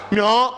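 明儿 said thick-tongued, the 儿 slurred; 明儿 with a clumsy, swollen tongue; big-tongue lisp. Shown as a demonstration. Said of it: "你搁到大舌头怎么说？".

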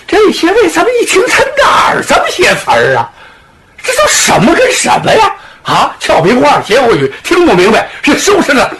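high, bewildered exclamations; baffled outcry. Acted. Bandits baffled by the bizarre insults, crying out high. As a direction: cry out in disbelief at the nonsense.

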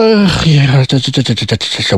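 stuttering helplessly, voice sinking away; panicked stammer. Acted. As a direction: stumble over the same word, cornered. Caught out, lost for words.

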